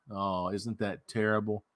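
normal talking voice

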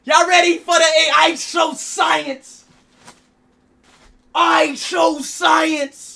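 slow, high, loud showman shout; hype announcer. Acted. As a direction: drag it out like a ring announcer.

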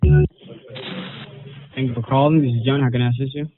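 usual speaking voice

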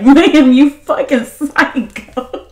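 slow, drawn-out disbelief at her; slowed, incredulous mock address to the captor; mock outraged thought. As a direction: spell out each word like you can't believe her. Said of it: "stuck on her smile like".

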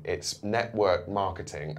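ordinary talk, nothing marked

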